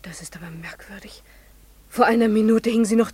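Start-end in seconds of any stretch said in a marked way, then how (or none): 0.0-1.2 hushed, unsettled whisper; astonished whisper. Acted. Frau Schlefing on the phone finds the feather gone, hushed whisper. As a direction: barely breathe it, unnerved.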